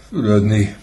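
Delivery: yawning it out, loud and high; yawned. Acted. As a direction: say it through a big yawn. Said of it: "ásított Suhajda". (ásítva, hangosan)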